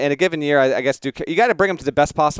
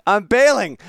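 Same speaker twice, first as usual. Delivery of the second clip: higher, louder, mock-dramatic surrender; louder laughing give-up; 9 semitones higher, 5 dB louder, slower than usual. Real, not acted. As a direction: throw in the towel theatrically. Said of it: "I wasn't prepared for it".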